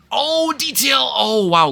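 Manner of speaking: high startled yell at the crash; excited shout. Real, not acted. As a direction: cry out in sudden excitement. Sudden pass in lead battle.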